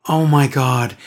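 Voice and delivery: ordinary talk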